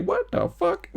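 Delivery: very high-pitched incredulous outburst; imitating losing it. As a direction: squeal it as a mock meltdown. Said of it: "he just loses it and it's like".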